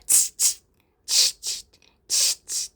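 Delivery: voiceless "tst" monkey chatter. A narrator's reading. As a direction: mimic monkeys with sharp little tongue-and-teeth noises. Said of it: "the monkeys only stamped both their feet back at him".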